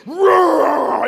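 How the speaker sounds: loud imitation tiger roar. Fan wanting Mike the Tiger to roar, tiger roar. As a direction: roar like a big cat. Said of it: "I wanna hear Mike go".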